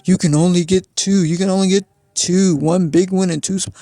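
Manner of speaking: imitating the worker repeating the rule; quoting the man. Worker scolding her about the plate limit, insistent. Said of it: "He's like".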